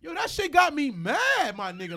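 fuming, dragging the words out hot; really mad. Real, not acted. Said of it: "Like, I'm really mad".

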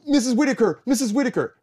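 high, loud call across the stage; calling out loudly. Retold or imitated. Student calling out to teacher on stage, loud and high. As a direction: call out to the teacher to get her attention. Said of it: "which I was not calling her by her rehearsal name".

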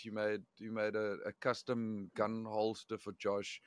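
ordinary delivery

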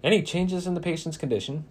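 flat monotone doctor. Acted. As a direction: say it with no feeling. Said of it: "asked in a monotone voice".

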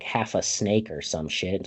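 ordinary delivery

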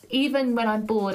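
ordinary talk, nothing marked

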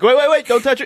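high, urgent "wait wait"; excited high cry. Retold or imitated. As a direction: stop everyone, high and excited.

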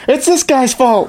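high, slow put-on blame; whiny blaming voice. Mocking the president blaming others, high and slow. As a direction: point the finger in a silly voice.

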